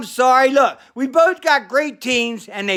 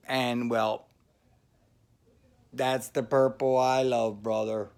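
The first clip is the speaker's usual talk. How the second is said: much softer and lower; low aside; 9 semitones lower, 10 dB quieter than usual. Acted. Bergman confiding his love of purple, softly. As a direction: drop it to a confiding rumble.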